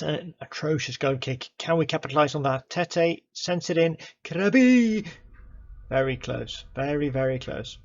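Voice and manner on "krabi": loud, high yell of the player's name; excited shout. Real, not acted. Commentating a near miss, a loud excited shout. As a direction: shout it as the shot flies in.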